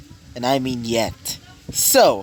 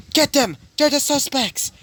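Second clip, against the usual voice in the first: guards yelling; 10 semitones higher than usual. Acted. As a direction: bark out the order to seize them. Guards calling out to chase.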